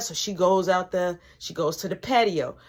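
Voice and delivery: ordinary delivery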